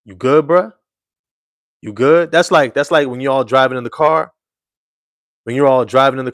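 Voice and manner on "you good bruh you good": raised, worried "you good" to a teammate; concerned check-in. Retold or imitated. Teammates checking on Cade, concerned and raised. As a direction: ask if he's okay, half alarmed. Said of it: "we're looking at you like".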